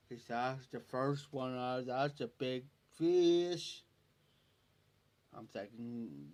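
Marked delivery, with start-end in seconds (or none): 0.4-3.8 slow, stretched-out imitated drawl; slow talker imitation. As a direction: mimic his amazement, dragging each word. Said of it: "That's how he talked".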